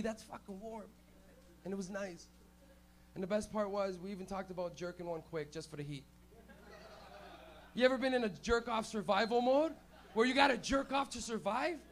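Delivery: ordinary talk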